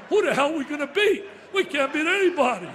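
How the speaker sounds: full-throated rallying cry; fired-up shout. Retold or imitated. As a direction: yell it like a coach firing up the room. Fired-up retold pep talk.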